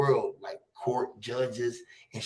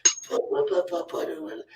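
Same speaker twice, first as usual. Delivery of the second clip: mock kid going off; 4 dB quieter, slower than usual. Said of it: "the little boy was in there going off".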